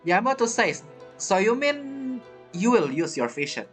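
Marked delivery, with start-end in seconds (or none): none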